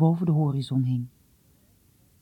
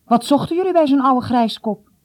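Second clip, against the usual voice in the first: high, loud, disdainful question; irritated; 7 semitones higher, 7 dB louder than usual. A narrator's reading. Father questions son about visits to old Huino, irritated. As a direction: demand an answer with irritation.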